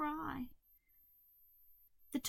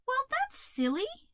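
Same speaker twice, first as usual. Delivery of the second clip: high, light caterpillar voice; reassuring Hazel; 14 semitones higher, 3 dB louder than usual. A narrator's reading. Hazel cheering up the toad, reassuring. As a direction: lift the pitch to brush it off kindly.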